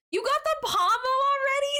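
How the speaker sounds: excited squeal. Real, not acted. Streamer thrilled at viewer's catch.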